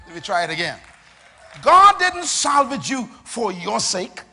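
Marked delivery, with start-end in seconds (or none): 1.6-4.2 high, loud, drawn-out pulpit delivery; full-force preaching. Real, not acted. Preacher telling listeners God protects his name, high and emphatic. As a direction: drive each phrase home, raised and forceful.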